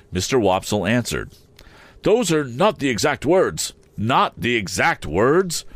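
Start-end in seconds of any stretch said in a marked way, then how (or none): 2.0-3.7 high, fussy Mr Wopsle voice; pompous Wopsle. A narrator's reading. 4.0-5.7 slow, bitter repeat. A narrator's reading. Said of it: "repeated the gentleman bitterly".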